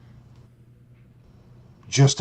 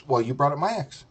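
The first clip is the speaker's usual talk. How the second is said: ordinary delivery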